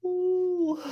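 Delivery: high drawn-out hoot; high "ooh". Retold or imitated. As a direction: let out a long, high "ooh". Mimics own cackling reaction to show.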